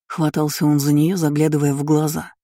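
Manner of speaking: ordinary delivery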